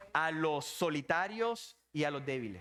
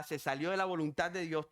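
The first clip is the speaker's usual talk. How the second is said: ordinary talk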